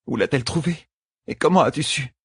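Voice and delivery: put-on high, astonished young woman's voice; amazed questioning. A narrator's reading. Young woman asks Rand how he knew, high and amazed. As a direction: ask in wonder, pitched well up.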